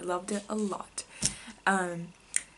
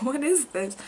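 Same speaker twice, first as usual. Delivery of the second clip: puzzled; 6 semitones higher, 4 dB louder than usual. Retold or imitated. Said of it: "her face just being like".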